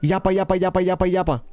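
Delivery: light imitation of fast salesmen chatter; mock fast yapping. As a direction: rattle off yappa yappa like a chatterbox. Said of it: "those guys be".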